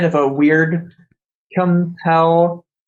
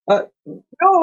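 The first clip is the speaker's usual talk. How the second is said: high-pitched, feeble call after her; a hint of someone pleading to stop her; lightly voiced protest; 13 semitones higher than usual. Voices character chasing after an angry woman.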